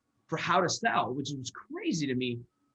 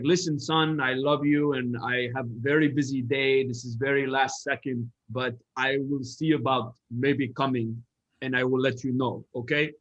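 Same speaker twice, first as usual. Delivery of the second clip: a son mimicking his busy dad in a Middle Eastern accent; a fair Middle Eastern accent impression of a dad promising maybe; thick Middle Eastern accent. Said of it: "in his thick Middle Eastern accent".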